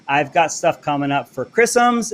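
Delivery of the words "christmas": put-on "chrisms" said as two syllables, like a small child; kid's chrisms. Host repeats his daughter's word, "chrisms" said childlike. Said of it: "as my youngest daughter used to say".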